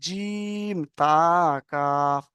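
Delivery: very slow recitation of letter names; drawn-out letter recitation. Shown as a demonstration. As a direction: stretch each letter name long and sung. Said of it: "তাহলে কি হতো?".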